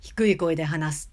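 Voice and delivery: low-pitched demo. Shown as a demonstration. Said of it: "高低は".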